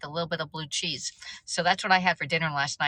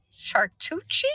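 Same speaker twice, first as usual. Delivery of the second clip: normal talking voice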